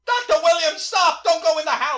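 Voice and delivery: high, loud, alarmed call; urgent warning cry. Acted. Ghost warning doctor off the house, urgent. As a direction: call out to stop someone in danger.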